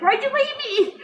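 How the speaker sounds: high, laughing why'd-you-leave-me; half-laughing plea to the dead brother; mock plea. Retold grief, pleading brother.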